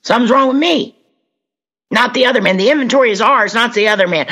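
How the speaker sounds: high, earnest recital of the Big Book; reciting the book in a high voice. Retold or imitated. Quoting the twelve and twelve in a high recital voice. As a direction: raise the pitch and recite it like a creed. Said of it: "it says".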